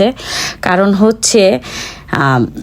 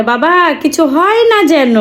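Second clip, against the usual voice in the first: put-on higher, sing-song little child's voice; imitating toddler; 7 semitones higher, slower than usual. Mother imitating her toddler seeing dad off at the stairs, childlike sing-song. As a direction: mimic the toddler's sweet farewell. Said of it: "ও একদম সিঁড়ি পর্যন্ত যেয়ে ওর বাবাকে বলে".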